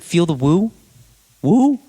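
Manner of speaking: high, loud woo called out; mock rapper ad-lib. Telling his girlfriend about [name], yelling the woo ad-lib high and loud. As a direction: belt the woo like a hype ad-lib.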